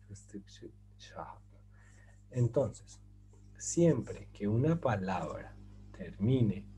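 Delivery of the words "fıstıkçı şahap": Fıstıkçı Şahap whispered, very soft and slow; slow whisper of Fıstıkçı Şahap, falling at the end; whispered repeat. Shown as a demonstration. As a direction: breathe Fıstıkçı Şahap slowly to help memorizing. Teacher repeats the mnemonic rule.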